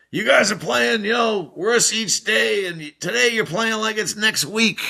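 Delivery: high-pitched coach impression; Herbie impression. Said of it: "the funny things that Herbie would say".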